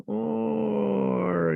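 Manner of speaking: long drawn-out, high-pitched "or"; mock ultimatum. Company giving users an ultimatum, mockingly drawn out. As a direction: stretch it high like a teasing threat.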